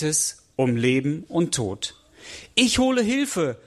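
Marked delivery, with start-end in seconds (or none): 2.5-3.6 high, urgent calling out. A narrator's reading. Boy running off for help, urgent call. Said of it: "ruft er und rennt los".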